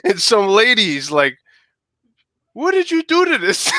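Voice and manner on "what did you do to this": high, shocked question. Retold or imitated. Teen brings back kicked chicken, lady mock shocked. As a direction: imitate a lady appalled at the bloody chicken. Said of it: "she was, like, shocked".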